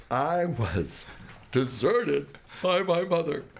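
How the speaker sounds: milk it for sympathy, mildly overdone; playing the victim. Telling the Buddhist group his sad story, mildly hammed-up pity. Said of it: "playing it for all it was worth".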